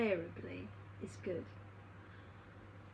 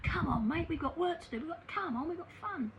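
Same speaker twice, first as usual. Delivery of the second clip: much higher, excited call to a buddy; eager call; 9 semitones higher, 4 dB louder than usual. Retold or imitated. Dead friend urging his mate to come along, excited and high. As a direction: voice the friend keen to start the adventure, high and bright with eagerness.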